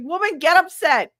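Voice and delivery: exasperated plea. Retold or imitated. Urging the calm nurse.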